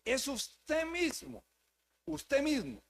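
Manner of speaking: slow and high, pressing each word; insistent emphasis. Real, not acted. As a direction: drive home mismo slowly. Preacher stressing personal responsibility.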